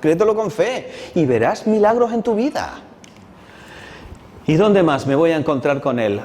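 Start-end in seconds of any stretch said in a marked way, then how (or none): 0.0-2.8 high, strong impassioned preaching; rousing sermon climax; fervent preaching. Acted. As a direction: preach it with rising fire.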